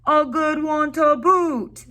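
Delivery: high, loud, slow crew echo; chorus it back like a gang of pirates; rowdy crew chanting agreement; pirate crew agreeing. A narrator's reading. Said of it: "the others agreed".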